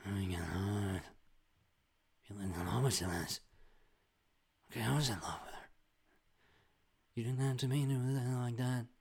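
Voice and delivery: indistinct, hushed drama murmuring; TV drama cadence. Acted. Overheard TV show dialogue, murmured drama cadence. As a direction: parody the singsong of TV dialogue. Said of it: "the cadence is just always gonna be".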